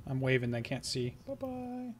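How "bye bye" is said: tiny falsetto farewell, very soft; very high, hushed little bye-bye; playful goodbye. Pretend. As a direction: wave them off in a small high singsong. Playful sign-off, said once more.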